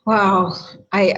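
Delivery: usual speaking voice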